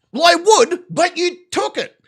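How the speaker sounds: high, loud outburst, slowing at the end; indignant protest. Acted. Driver tells prosecution they took his money, indignant. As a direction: throw the absurdity back at them.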